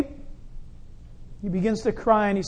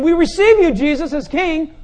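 imagined acclaim; 6 semitones higher, 7 dB louder than usual. Pretend. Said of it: "What if they would have kept saying".

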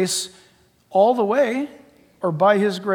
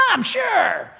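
sarcastic; 10 semitones higher than usual. Retold or imitated. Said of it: "And people go".

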